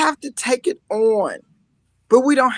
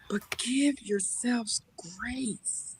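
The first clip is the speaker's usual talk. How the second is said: breathy whisper, slow and tender; whispered advice; 14 dB quieter, much slower than usual. Real, not acted. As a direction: hush it like a secret for a friend.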